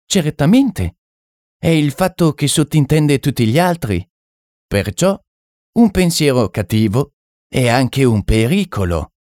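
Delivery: high-pitched mystic, stretching key phrases; French medium. Acted. French medium explaining that thoughts are things, high and assured.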